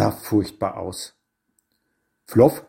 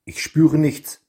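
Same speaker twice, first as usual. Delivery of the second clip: usual speaking voice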